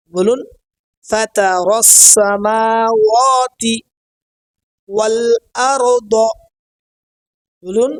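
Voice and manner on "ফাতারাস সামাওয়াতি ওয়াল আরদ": ফাতারাস সামাওয়াতি ওয়াল আরদ slowly, with held long vowels; correct pronunciation. Shown as a demonstration. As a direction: stretch সামাওয়াতি, then ওয়াল আরদ carefully apart. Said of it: "উচ্চারণগুলো সহি হয়".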